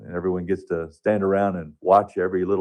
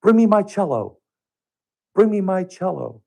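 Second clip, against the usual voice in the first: high, loud call across the room; 11 semitones higher, 4 dB louder than usual. Retold or imitated. Elderly patient calling to her son for her cello, loud and high.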